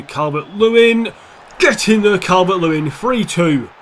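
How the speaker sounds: loud, elated shout; loud cheering shout of get in there; goal celebration. Real, not acted. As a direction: roar the goal in.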